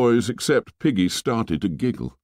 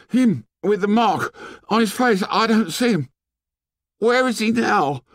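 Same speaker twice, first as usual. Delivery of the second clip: high-pitched gasped words, broken by pauses; gasping. A narrator's reading. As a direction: gasp it out high, fighting for breath. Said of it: "gasped Piggy".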